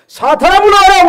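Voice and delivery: very high, loud command to Satan; proclaiming shout. Shown as a demonstration. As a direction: cast the enemy out at full voice. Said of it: "우리가 선포해야 되는 거예요". (크고 높게 선포하며 외치는 명령)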